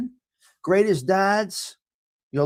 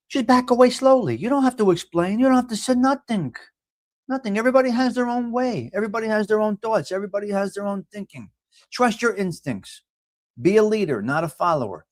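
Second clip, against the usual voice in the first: higher, preachy horoscope advice; sermon-like advice; 5 semitones higher than usual. Acted. Host urging viewers to trust their instincts, preachy and higher.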